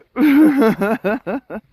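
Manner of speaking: bursting into real laughter; high, loud laugh at a comment. Real, not acted. Laughing at chat.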